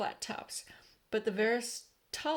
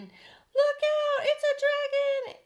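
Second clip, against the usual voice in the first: high, loud, slow terrified cry; panicked shout; 10 dB louder, much slower than usual. A narrator's reading. Firemen shouting in fright at the centipede. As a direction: shriek the warning in panic. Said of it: "all started shouting".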